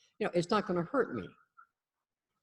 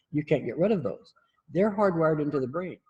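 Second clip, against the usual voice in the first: usual speaking voice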